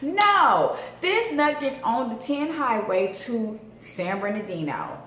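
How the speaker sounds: ordinary talk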